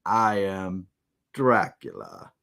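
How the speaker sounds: low, deep, slow vampire announcement; Dracula impression. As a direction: drop the voice deep on the name. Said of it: "how about this line".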